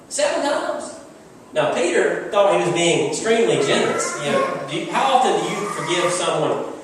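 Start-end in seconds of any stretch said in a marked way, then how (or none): 0.1-1.0 seven times rising high as a hopeful offer; hopeful question. Acted. Peter asking Jesus, rising high on seven times. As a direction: pitch it up like a proud guess.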